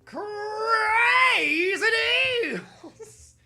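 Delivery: very high hammed-up salesman holler; Crazy Red. Salesman announcing crazy deals, high drawn-out shout. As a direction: wail it out like a crazed TV pitchman. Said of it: "Crazy Red here".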